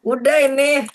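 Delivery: high loud fed-up cry; loud exasperated. Real, not acted. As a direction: call it out, exasperated. Kid struggling with the game.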